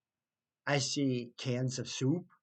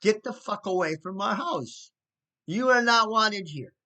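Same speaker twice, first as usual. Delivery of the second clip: higher, rising to a louder mock yell; half-hearted shout building at the end; retold yelling; 5 semitones higher, 5 dB louder than usual. Neighbor retells older sister yelling at stepdad, building mock yell. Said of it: "was yelling at him".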